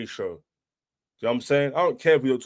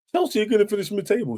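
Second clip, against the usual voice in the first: higher, whiny mock rival fan voice; mocking the haters; 7 semitones higher, faster than usual. Fan mimicking rival haters with a whiny mock voice. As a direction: sneer their words back in a silly voice. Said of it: "When everyone was like".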